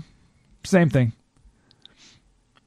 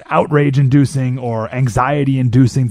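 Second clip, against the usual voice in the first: ordinary talk, nothing marked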